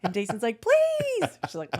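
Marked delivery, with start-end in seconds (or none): 0.6-1.3 very high, loud pleading squeal; mock begging. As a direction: imitate a kid wheedling at full pitch. Younger girl begs for share.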